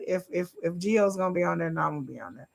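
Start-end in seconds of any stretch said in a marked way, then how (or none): none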